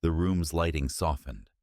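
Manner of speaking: usual speaking voice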